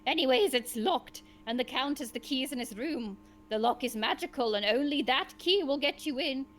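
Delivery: ordinary delivery, nothing marked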